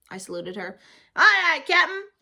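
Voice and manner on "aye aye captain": high, loud jokey salute; a child saluting her mother as a joke; mock salute. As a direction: play the cheeky sailor. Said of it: "I said, real hilarious".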